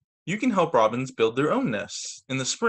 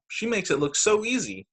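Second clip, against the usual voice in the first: put-on child voice; 8 semitones higher than usual. Sam's thought voiced.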